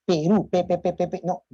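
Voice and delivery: repeating the first syllable pe in a stutter; stuttering demo. Shown as a demonstration. Teacher demonstrating a clonic stutter to students. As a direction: stammer on Perú, pe pe pe pe. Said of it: "frecuentes y repetitivas de sílabas iniciales".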